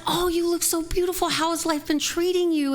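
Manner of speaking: high, sweet, over-polite compliment; sugary compliment. Retold or imitated. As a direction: pour on the sweetness. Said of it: "And I said".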